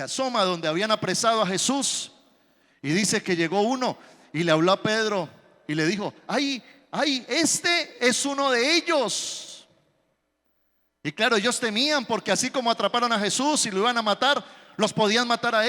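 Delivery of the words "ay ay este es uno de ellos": higher, slow, pointing-out voice; lightly voiced nosy bystander; drawn-out, tattling accusation; mock accuser.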